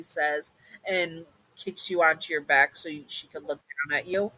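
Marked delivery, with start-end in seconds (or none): none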